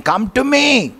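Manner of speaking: soaring high on come to me; high calling invitation. Retold or imitated. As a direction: call it out high like an open invitation. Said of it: "He's saying".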